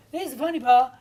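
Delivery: mock funny-part announcement. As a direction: flag up the joke brightly, as a parody. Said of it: "disconnect between the, the humour and the direction".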